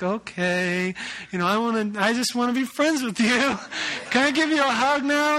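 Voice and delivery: overly sweet, begging to be friends; husband trying to make peace with the lady, hammed-up sweet; too nice. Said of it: "I'm, like, too nice".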